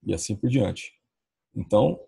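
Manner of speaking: ordinary delivery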